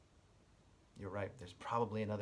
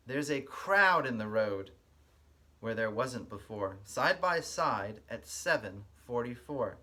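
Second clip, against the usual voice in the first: lively storybook read-aloud with a sing-song lilt; reading to children; 6 dB louder than usual. Acted. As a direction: read it like story time for little kids. Reads picture book aloud.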